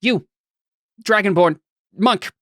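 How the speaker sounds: single people out with demands; demanding. Acted. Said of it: "making demands".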